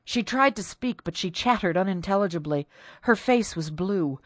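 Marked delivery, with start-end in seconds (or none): none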